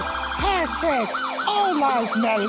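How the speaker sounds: rhythmic chant-like spoken word with music; a lament with music; spoken-word piece over music. Acted.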